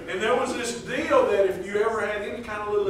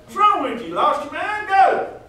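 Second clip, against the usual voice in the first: put-on high, loud shout to shoo a boy off; mock counselor yell; 10 semitones higher, 4 dB louder than usual. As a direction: bark a kid back to his room, half joking. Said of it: "and I said".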